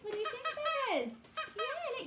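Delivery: high, giggly and loud; playful squeal. Acted. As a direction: squeal with glee at the puppet. Playful voice talking to penguin.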